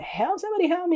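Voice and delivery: high-pitched, half-volume cry for rescue; mock shout for help. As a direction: call for help up high, without real force.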